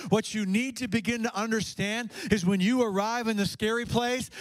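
ordinary talk

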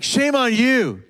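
imitated voice of a mocking accuser; accusing enemy. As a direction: heap shame on them with incredulous taunting questions. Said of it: "the voice of the enemy".

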